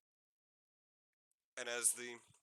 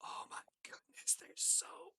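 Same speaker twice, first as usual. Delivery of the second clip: breathless whisper of wonder; awed whisper. Acted. Fairy girl whispering over the horns. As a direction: whisper it, amazed. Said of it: "is like".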